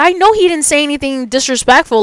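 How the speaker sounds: ordinary talk, nothing marked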